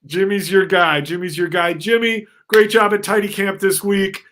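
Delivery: loud, high emcee praise; announcer voice. Acted. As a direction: hype him up from the stage. Headmaster praising on stage.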